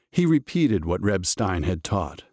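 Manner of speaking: ordinary delivery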